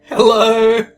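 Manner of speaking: loud, sudden shout; loud hello. Acted.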